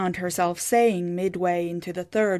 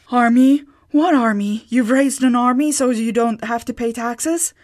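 loud incredulous questions; aghast, louder; shocked disbelief; 4 semitones higher, 6 dB louder than usual. A narrator's reading. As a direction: she can't believe what she heard. Sigrid shocked at news.

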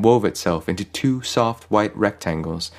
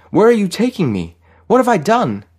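very high, loud, panicked questions; alarmed, protesting shout; calling out; 13 semitones higher, 6 dB louder than usual. A narrator's reading. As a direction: cry out to the one carrying you off. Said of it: "Farid called out".

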